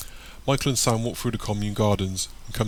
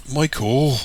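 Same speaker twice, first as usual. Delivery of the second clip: higher, louder exasperated name-call; through gritted teeth; 4 semitones higher, 5 dB louder than usual. A narrator's reading. Sam struggles free from tickling, through gritted teeth. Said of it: "Sam says through gritted teeth".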